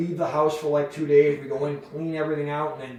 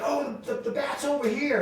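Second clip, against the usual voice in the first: woman's voice pitched way up; high imitation of the homeowner; high mock woman; 11 semitones higher than usual. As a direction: play the flustered homeowner, high. Woman calls out about the bat.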